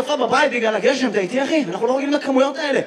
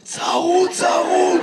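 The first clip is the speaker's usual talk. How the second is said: hoarse, raised-pitch answer; 4 semitones higher, much slower than usual. Real, not acted. Audience man explaining to the comedian why he sounds like that, hoarse. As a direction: force the word out through a hoarse throat. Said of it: "למה אתה נשמע ככה".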